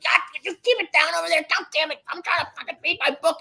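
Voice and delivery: high, fast whiny yell; nasal old-man complaint over the fence; grumpy loser neighbor. Retold or imitated. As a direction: neighbor yelling at the kids to keep it down. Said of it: "he's like".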